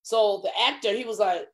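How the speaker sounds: usual speaking voice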